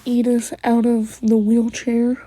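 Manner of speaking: usual speaking voice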